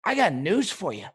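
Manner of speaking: ordinary talk, nothing marked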